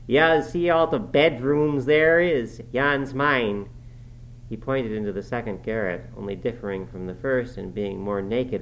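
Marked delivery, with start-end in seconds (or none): 0.0-3.7 put-on rough, louder dialect voice of an old servant; gruff Yorkshire servant. A narrator's reading. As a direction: grumble it like a surly farmhand. Joseph's dialect voice.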